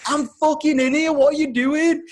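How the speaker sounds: loud, high, furious yell; screaming. Retold or imitated. Man on the toilet to intruder, screaming. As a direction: scream it at whoever's at the door. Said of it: "and I'm screaming".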